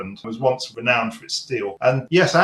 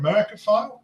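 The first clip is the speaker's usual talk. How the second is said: "Americophile" tried out higher, quick, as a question; testing a made-up word; unsure coinage; 9 semitones higher, faster than usual. Real, not acted. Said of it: "knows the correct word".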